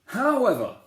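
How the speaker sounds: a king turning the result around, high and loud; king's dramatic however. Acted. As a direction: stop the crowd short.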